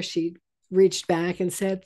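normal talking voice

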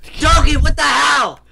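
very loud, high shout; high, exasperated holler; outraged yell. Real, not acted. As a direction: yell in disbelief at a teammate. Yells at teammate over mishap.

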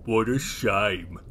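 low, slow creature voice; Big. Acted. Biggest naughty Lloyd pities Oisin, low and slow. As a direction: drag out low mock sympathy. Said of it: "inked Big".

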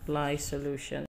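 ordinary delivery

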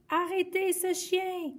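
high call for help; shouting. Acted. Guy chasing the dog and yelling for help, high call. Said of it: "a crié Guy".

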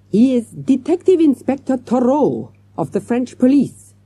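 put-on high, emphatic French-accented announcement; agitated French manager. A narrator's reading. Manager revealing the inspector to children, emphatic.

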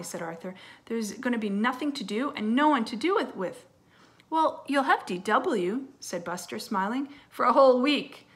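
lively storybook read-aloud; reading to children. Acted. Teacher reading a story to her class, lively storytelling. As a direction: read it brightly to young kids. Reads a picture book aloud.

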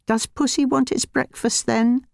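high sing-song pet talk; cooing to cat. A narrator's reading. Old woman coos at her cat about breakfast, high and sing-song. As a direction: coo at the cat like a baby.

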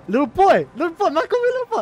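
high, loud indignant yell; outraged shouting. Real, not acted. Streamer repeating a viewer's insult to chat, outraged shouting. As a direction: shout it in disbelief, voice shooting up. Outraged at being called little boy.

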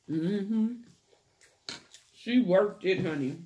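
talking while chewing; muffled through food; mouth full. Real, not acted. Seen 0: bite taken at -2; cheeks full, chewing throughout labelled words.

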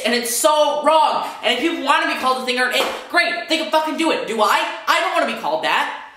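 real anger pushing the voice up; mad rant. Real, not acted. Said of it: "I'm literally so mad".

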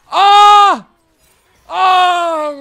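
loud high frustrated scream; pained yell. Real, not acted. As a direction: let out a sharp yell of frustration. Gamer reacts to dying. (yüksek, tiz öfke çığlığı)

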